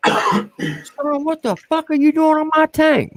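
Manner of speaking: high mock shriek of a furious colonel; outraged colonel squeal. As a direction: ham up the colonel losing his mind. Colonel yelling at private.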